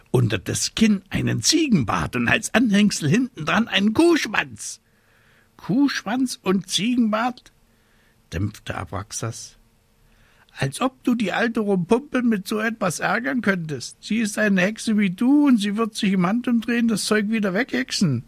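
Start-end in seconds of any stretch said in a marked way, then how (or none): 0.1-4.8 increasingly high gleeful listing; mounting spite. Acted. Witch piling up hexes on her enemy, mounting spite. 5.6-7.5 high-pitched incredulous bird voice; sceptical raven. Acted. Raven dampening the witch's plan, high scepticism. 10.6-18.2 high, chattering bird voice; dismissive raven. Acted. Raven talking witch out of hexes, high dismissive.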